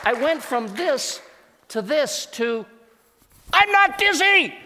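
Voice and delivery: very high, loud, laughing burst of joy; joyful shout. Shown as a demonstration. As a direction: show the leap from sick to healed. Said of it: "I need you to see this".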